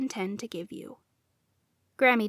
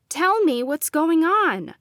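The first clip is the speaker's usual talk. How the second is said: louder, slow, insistent demand; 6 semitones higher, 5 dB louder, slower than usual. A narrator's reading. Granddaughter demanding answers from grandmother, firm and loud. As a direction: press her for the truth. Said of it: "I demanded".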